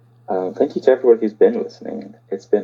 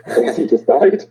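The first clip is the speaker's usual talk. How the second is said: higher, louder with a laugh; 7 semitones higher, 3 dB louder than usual. Real, not acted. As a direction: laugh through the line.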